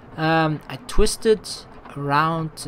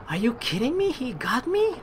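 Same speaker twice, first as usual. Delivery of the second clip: incredulous; 8 semitones higher, 5 dB quieter than usual. Real, not acted. Game claw grabs them.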